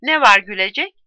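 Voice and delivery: loud irritated snap; annoyed at the laughter; suddenly angry. A narrator's reading. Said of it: "Longin birden sinirlendi". (sinirli, yüksek sesle)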